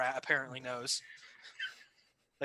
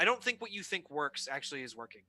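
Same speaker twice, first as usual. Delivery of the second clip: ordinary delivery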